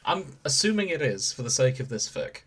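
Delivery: usual speaking voice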